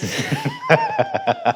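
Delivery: high, hearty burst of laughter. Real, not acted. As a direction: burst out laughing at your own remark. Laughing at ten kids remark.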